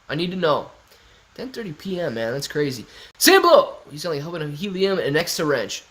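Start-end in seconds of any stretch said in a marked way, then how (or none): 3.2-3.8 calling out. Real, not acted. Greeting a chat member.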